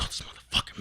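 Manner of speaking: voiceless, seething mock mutter; retold pissed-off muttering. Player imitating pissed-off friend marching back and forth, hushed angry muttering. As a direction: curse under your breath as the angry friend. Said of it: "he was fucking pissed".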